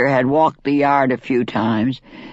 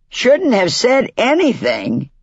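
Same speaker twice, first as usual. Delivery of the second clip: incredulous echo; 8 semitones higher than usual. Acted. Chicken echoes sister's words.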